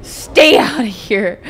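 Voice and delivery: loud drawn-out yell to leave; angry shout. Pretend. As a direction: bellow it at her. Yelling at NPC.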